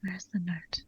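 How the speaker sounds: hushed, voiceless whisper; whispered echo. Real, not acted. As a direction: whisper the question back to yourself. Player echoes the character's question.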